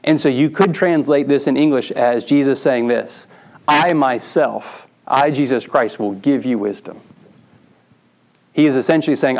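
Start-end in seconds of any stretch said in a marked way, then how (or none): 3.7-7.0 slow stress on "I myself", drawn out with a pause after it; emphatic I myself. Shown as a demonstration. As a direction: lean hard on "I myself" as Jesus speaking. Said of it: "the Greek is emphatic".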